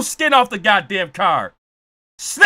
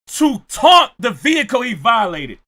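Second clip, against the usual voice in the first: usual speaking voice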